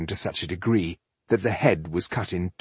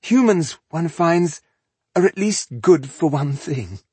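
higher-pitched, disdainful elf villain; haughty elf; 7 semitones higher than usual. Acted. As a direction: look down on the humans, pitched up. Villain elf addresses his human foes.

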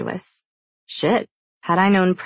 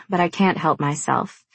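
plain reading voice

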